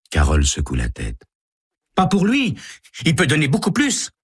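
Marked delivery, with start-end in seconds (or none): 1.9-4.1 put-on much higher, insistent teen; insist, high and cocky; bratty girl. Carole insists.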